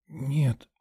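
put-on faint girlish denial; weak reply. A narrator's reading. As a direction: answer faintly, still in shock. Dazed nurse answering rescuer.

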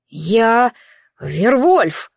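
a lightly voiced proud boy; proudly. A narrator's reading. The boy tells the woman what he is, mildly proud. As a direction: announce "Вервольф" with a touch of pride. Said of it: "гордо ответил мальчик".

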